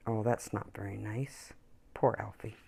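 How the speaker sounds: quiet sorry aside; soft pity. A narrator's reading. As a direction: sigh over poor Alfie.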